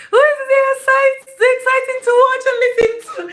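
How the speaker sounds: high, loud, gushing praise; excited shout-out. Real, not acted. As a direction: burst out high and bright for the chat. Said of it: "excited shout-out to viewers".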